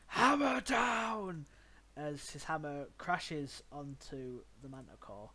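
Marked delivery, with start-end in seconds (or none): 0.1-1.5 high, loud drawn-out yell; screamed battle cry. Acted. Wilhelm brings his hammer onto the manticore, screaming. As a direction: roar it out as the blow falls. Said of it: "Wilhelm raises his hammer up and screams".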